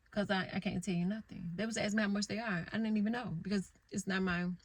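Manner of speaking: normal talking voice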